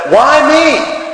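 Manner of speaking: a wronged church lady's rising fury; angrier. Retold or imitated. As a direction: complain to God, voice climbing high. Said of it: "She gets angrier".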